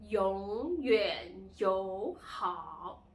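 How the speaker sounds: 永远友好 very slow and loud, swooping tones; teacher's exaggerated tone drill on 永远友好; third-tone demo. Shown as a demonstration. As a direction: stretch each syllable of 永远友好 through its dip and rise. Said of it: "these are the four tones".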